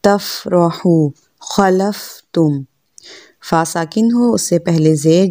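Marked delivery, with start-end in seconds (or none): 0.0-2.6 slow demo of फ़ा in तफ़रहू and खलफ़तुम; careful fa. Shown as a demonstration. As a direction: say तफ़रहू and खलफ़तुम slowly, teeth on lip for फ़. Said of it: "ऊपर वाले दांत नीचे वाले होंठ से लगेंगे".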